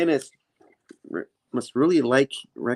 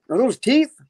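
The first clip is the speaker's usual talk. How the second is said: high-pitched mock alarm; alarmed question; 14 semitones higher, 4 dB louder than usual. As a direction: ask it aghast, voice shooting up.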